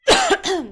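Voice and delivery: loud, short hacking cough; real cough. Real, not acted. Host talking too much on stream, real cough. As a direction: clear the throat with a hard cough. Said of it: "I'm already talking too much".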